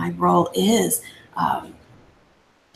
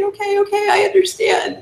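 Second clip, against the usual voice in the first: high, teary voice from the imitated memory; a lightly imitated sniffly yes through tears; tearful; 12 semitones higher than usual. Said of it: "kinda sniffling through tears and saying".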